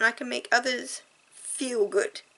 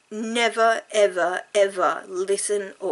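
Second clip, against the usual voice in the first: "never", "ever", "ever" each punched louder as a separate beat; stress on never ever; 7 dB louder than usual. Real, not acted. Promising her viewers she'll never act on it, hammering "never, ever, ever". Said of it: "I repeat".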